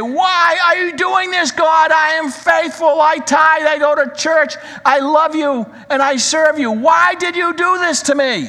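high, loud, bitter outcry at heaven; furious shout at God. Pretend. A sufferer shaking his fist at heaven, furious shout. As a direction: shake your fist and yell up at God. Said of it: "shake his fist towards heaven and say".

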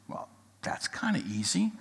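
unvoiced whisper of grandpa's thought; a whispered aside. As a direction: breathe it to yourself.

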